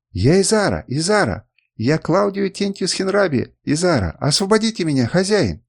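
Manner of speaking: high, insistent appeal; acted high-pitched begging; urgent pleading. A narrator's reading. Slave girl begs for freedom.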